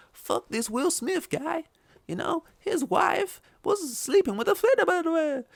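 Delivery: mock Chris Rock roast voice, high and slow; exaggerated mock voice. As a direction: ham up a sneering comedian. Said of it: "Say it in a, an exaggerated voice".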